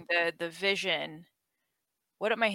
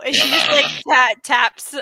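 excited; 3 semitones higher, 13 dB louder than usual. Real, not acted. Excited narration while laughing.

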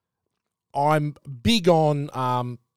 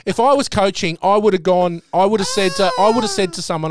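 much higher and louder, tumbling restarts; worked up; 7 semitones higher, 5 dB louder than usual. Real, not acted. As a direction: blurt it out, fired up and indignant. Heated rant about the coach.

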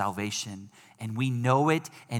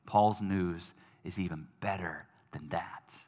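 whisper; 6 semitones lower, 7 dB quieter than usual. Real, not acted. Dramatic reveal in sermon.

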